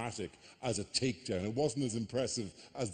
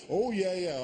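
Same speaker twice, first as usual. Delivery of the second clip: eager witness; 8 semitones higher, 5 dB louder than usual. Pretend. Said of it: "I would go".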